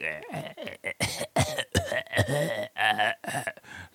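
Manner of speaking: long imitated hacking fit; coughing up a lung. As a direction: act out choking, retching coughs. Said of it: "coughing up a lung".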